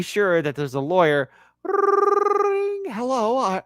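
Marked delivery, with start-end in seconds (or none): 1.6-2.8 high sung ring-tone imitation; mock phone ring. Joking about a lawyer calling, high mock ring. 2.9-3.6 lawyer answering. Pretend. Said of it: "this is not how the lawyer would sound".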